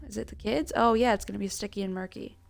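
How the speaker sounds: normal talking voice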